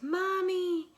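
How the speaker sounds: high, loud, drawn-out wail; scared little girl. Acted. Frightened girl crying out to mom, high and loud. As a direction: cry out for Mommy. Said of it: "And the little girl just says".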